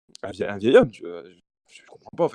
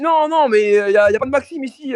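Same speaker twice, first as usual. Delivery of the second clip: high, loud flustered shouting; mock panicked denial; 10 semitones higher, 8 dB louder than usual. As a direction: yell through the door in a panic. Yelling through door, retold.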